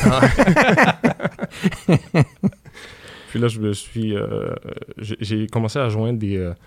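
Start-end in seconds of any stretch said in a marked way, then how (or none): 0.3-3.2 loud burst of laughter. Real, not acted. Big laugh at joke.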